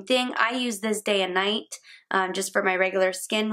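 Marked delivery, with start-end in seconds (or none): none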